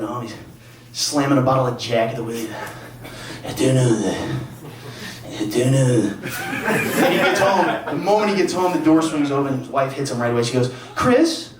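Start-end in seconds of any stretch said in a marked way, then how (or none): 3.4-6.1 low, worn-out newscaster catchphrase; mock drunken anchor in a low voice; Chris Renier. Reporter drinking on the drive home, low weary catchphrase. 11.0-11.5 high, rising mock wife's call; nagging wife. Wife greeting husband at the door, high nagging call. Wife hits him right away.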